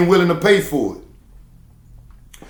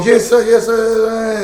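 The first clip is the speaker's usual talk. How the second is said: higher, eager chorus voice; loud chorus of yes sir; 6 semitones higher, slower than usual. Retold or imitated. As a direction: shout it back like a crowd of workers. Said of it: "a loud chorus of".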